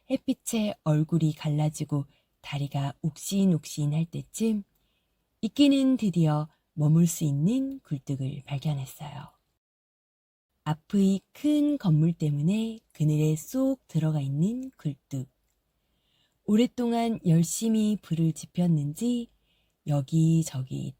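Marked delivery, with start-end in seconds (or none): none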